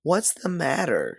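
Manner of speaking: high, slow call over the noise; shouting over a parade, frustrated holler. Acted. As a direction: holler over a brass band. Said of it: "she hollers above the celebration".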